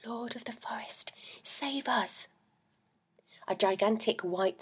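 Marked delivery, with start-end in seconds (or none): 0.0-2.3 a hint of a whispered call. A narrator's reading. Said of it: "Holly and the oak tree calling".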